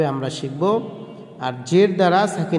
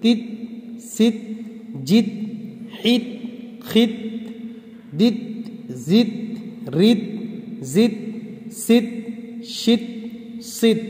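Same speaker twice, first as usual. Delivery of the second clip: single syllables with a rising lilt, paused between; syllable-only reading drill; 2 semitones higher, 4 dB quieter than usual. Shown as a demonstration. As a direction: read each syllable on its own, lifting it. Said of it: "উচ্চারণ করার". (একটা একটা করে শুধু উচ্চারণ)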